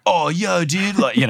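a hint of a laid-back surfer; lightly voiced Hawaiian surfer bro. Host imitating the surfer bro lightly. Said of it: "surfer bro out there that just talks".

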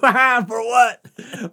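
high, loud, drawn-out disbelief; retold incredulous protest. Rider challenging cop's jail threat, retold incredulous protest. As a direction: slowly holler it as amused outrage.

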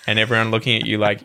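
usual speaking voice

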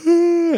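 very high imitated yawn; squeaky copied yawn; imitating a yawn. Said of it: "and they're like".

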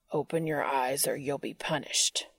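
low, threatening growl. Acted. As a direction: growl the command. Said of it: "Cass growled".

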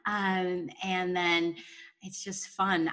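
ordinary talk, nothing marked

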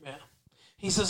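normal talking voice

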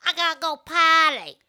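high whiny "I gotta go potty"; imitating a grown woman talking baby talk; mock childish whine. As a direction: whine it like a little kid needing the toilet. Mocking Gladys saying potty.

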